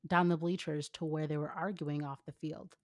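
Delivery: normal talking voice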